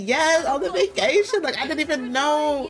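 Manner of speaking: high-pitched gushing reply; excited confirmation. Acted. Sim tells friends about the vacation, high and excited. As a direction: blurt it out, thrilled.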